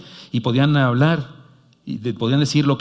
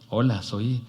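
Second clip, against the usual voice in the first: low, soft trance voice of a spirit; seance medium; 3 semitones lower, 7 dB quieter than usual. Retold or imitated. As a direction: speak as a possessed medium. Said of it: "la persona que se les mete es un doctor egipcio".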